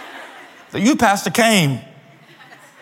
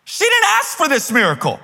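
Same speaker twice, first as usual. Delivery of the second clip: full force preaching; 7 semitones higher than usual. Acted. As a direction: preach it to the back row.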